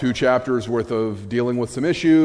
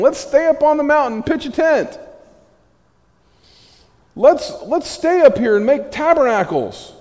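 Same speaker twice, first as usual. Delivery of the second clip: eager excitement; 14 semitones higher, 5 dB louder than usual. Retold or imitated. Voicing Peter wanting to camp.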